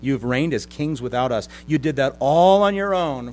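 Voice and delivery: emphatic sarcastic congratulation; mock them with exaggerated wonder; sanctified mocking. Preacher as Paul cutting through spiritual pride, pointedly mocking. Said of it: "a little sanctified mocking".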